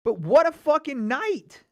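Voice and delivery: high, loud, slow exclaim; thrilled. Real, not acted. Streamer hyping the fight night to chat, slow loud thrilled exclaim. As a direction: savor the whole night out loud.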